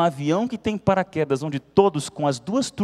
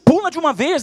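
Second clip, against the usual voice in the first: impatient order; 11 semitones higher, 5 dB louder than usual. Acted. Nervous pilots order her.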